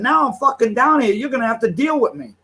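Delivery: heated challenge to the woman; retold threat. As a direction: square up and lay it down. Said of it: "I said".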